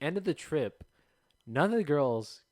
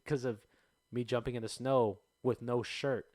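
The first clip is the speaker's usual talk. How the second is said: ordinary talk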